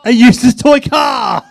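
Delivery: loud, high-pitched shout of discovery; exclaimed reveal. Real, not acted. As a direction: shout out what you have just found. Revealing a disappointing item.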